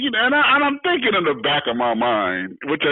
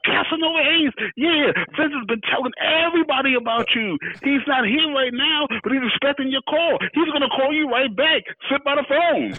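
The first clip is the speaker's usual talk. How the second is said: high-pitched, gushing secretary impression; high, eager phone greeting, acted out; excited secretary; 11 semitones higher than usual. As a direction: imitate a thrilled secretary, voice pitched way up. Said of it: "his secretary got all excited".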